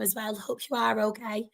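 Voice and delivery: normal talking voice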